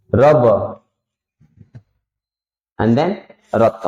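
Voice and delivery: रक, रन, रप, रफ, रब, रत with र sounded before the letter; r-first reading demo. Shown as a demonstration. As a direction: say the r first, then the consonant. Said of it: "तो रक". (पहले र, फिर अक्षर बोलें)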